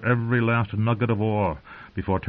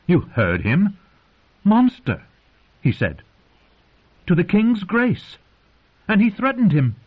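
high, loud indignant outburst; crying out; 13 semitones higher, 6 dB louder than usual. A narrator's reading. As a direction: protest loudly on the boy's behalf. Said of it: "cried Cersei".

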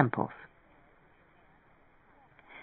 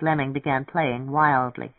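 usual speaking voice

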